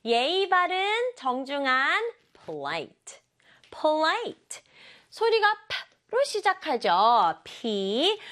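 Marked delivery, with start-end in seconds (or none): none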